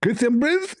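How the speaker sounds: high rising caricature call; exaggerated high-pitched interjection; Zakir Naik. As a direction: draw the name out upward in a mock squeaky voice.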